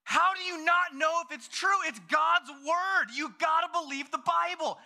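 put-on high, loud, outraged child; piercingly high, incredulous eight-year-old; worked-up kid. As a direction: imitate a little boy about to blow up. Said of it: "you're gonna have a heart attack".

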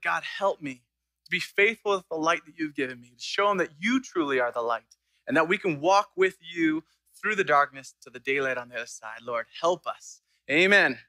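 a hushed congregational prayer. Acted. Pastor leads prayer.

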